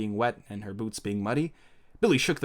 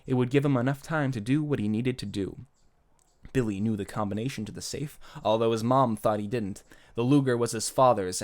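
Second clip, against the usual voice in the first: ordinary delivery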